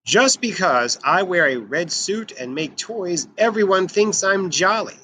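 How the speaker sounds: ordinary delivery, nothing marked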